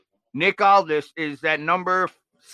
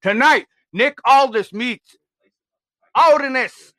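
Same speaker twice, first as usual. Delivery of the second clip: hype announcement; 5 semitones higher, 6 dB louder than usual. Acted. Annonce du main event.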